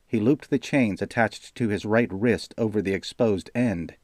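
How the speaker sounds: ordinary delivery, nothing marked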